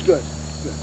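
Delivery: low, soft retold dying words; quiet deathbed voice. Dying Jackson answers his wife, quiet and low. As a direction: speak low and softly, as a dying man.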